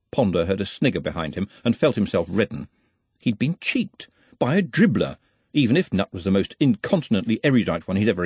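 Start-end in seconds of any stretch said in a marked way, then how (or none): none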